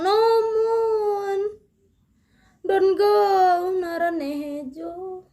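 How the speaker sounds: high begging cry; pleading. Acted. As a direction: beg him not to leave. Character begs dying friend.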